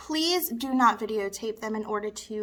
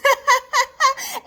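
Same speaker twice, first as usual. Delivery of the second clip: loud, high cackle; high, loud laugh; teasing laugh; 13 semitones higher, 8 dB louder than usual. Acted. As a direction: burst out laughing at your own jab. Laughs after jab at students.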